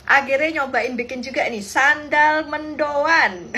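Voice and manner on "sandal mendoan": very slow words through laughter; laughing. Real, not acted. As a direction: drag it out while laughing. Laughing at her failed sandal snack.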